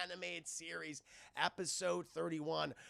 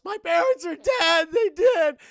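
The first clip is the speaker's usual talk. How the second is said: hammed-up wailing shout, much higher and louder; mock blubbering; 12 semitones higher, 17 dB louder than usual. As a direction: Dick bursting into mock tears over his parents. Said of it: "he just starts blubbering".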